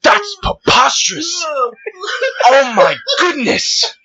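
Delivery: high, shouted mock outrage; high hammed-up outrage at mayonnaise corn. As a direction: ham up a prim onlooker appalled at corn. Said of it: "And they're like".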